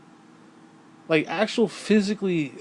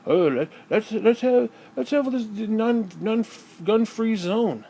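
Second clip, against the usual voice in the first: high, slow mock simpleton voice; mocking impression of naive morons; mocking dim voice; 7 semitones higher, slower than usual. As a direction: sneer at them in a dopey high voice. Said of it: "morons are like".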